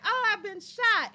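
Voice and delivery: loud, high, hammed-up shot victim; bad movie acting. Mimicking terrible Tubi movies, hammy bad acting. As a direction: badly fake being shot. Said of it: "those movies are so terrible".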